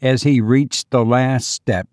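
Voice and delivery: usual speaking voice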